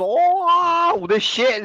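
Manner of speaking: high martial-arts yelp, imitating a kung fu film; mock Bruce Lee battle cry, hammed-up; Bruce Lee impression. Blokes blagging judo in a fight, mock Bruce Lee squeal. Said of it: "It's like Bruce Lee".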